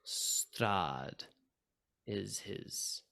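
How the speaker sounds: dropping low and soft, almost unvoiced; hushed name. GM breathing the vampire's name to the players, hushed. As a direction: let the dreaded name fade to a whisper.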